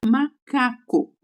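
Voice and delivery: slow, split into ma, ca, co; "macaco" broken into three separate beats; syllable by syllable. Shown as a demonstration. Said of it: "quando começa a contar sílabas".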